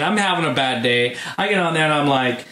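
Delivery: ordinary delivery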